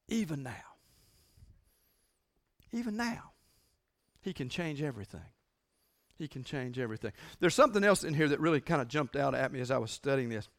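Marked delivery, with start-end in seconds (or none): 0.1-7.1 each "even now" softer until near silence; hushed fading repetition. Acted. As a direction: let it die away into a hushed promise. Repeating quote, fading away.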